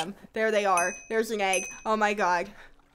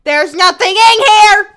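loud high exasperated shout; frustrated yell; 10 semitones higher, 14 dB louder than usual. Real, not acted. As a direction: bellow it at the empty room. Frustrated outburst in game.

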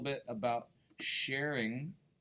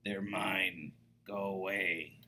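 grumbling Pig; 3 semitones lower than usual. Acted. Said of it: "Pig would just grumble".

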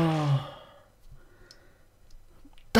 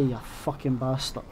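ordinary delivery

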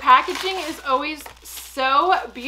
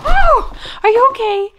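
high, loud worried call; anxious check on a startled dog; alarmed concern; 11 semitones higher, 13 dB louder than usual. Real, not acted.

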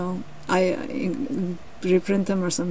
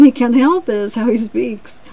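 ordinary delivery

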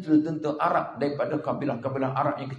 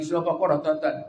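ordinary talk

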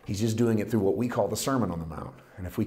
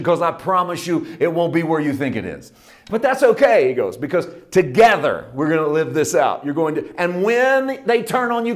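higher and louder, driving, impassioned sermon; full-force preaching; 8 semitones higher, 9 dB louder than usual. Acted. Preacher voicing Jesus to the congregation, impassioned full-force preaching. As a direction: preach it, building the fire.

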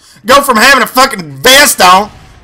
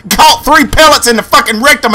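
normal talking voice

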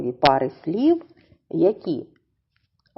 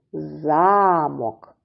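"Замок" with a drawn-out stressed За-; stress on first syllable; 2 semitones lower than usual. Shown as a demonstration. Teacher dividing the word for children, long stressed first syllable. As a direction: stretch ZA in "Замок": ZAAA-mok. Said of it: "припадає на перший склад".

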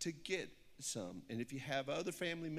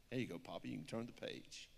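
ordinary delivery

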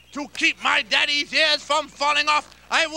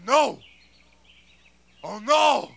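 ordinary talk

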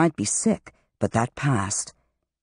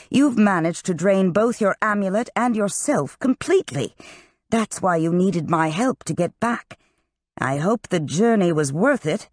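higher-pitched, emphatic rebuke; scolding master; 6 semitones higher than usual. A narrator's reading. Master to the student he rescued, scolding. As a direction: dress down a foolish pupil in a raised pitch. Griffin scolds Han in narrated story.

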